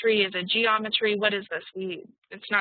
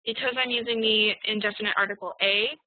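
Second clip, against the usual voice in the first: ordinary talk, nothing marked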